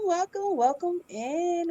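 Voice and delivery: ordinary talk, nothing marked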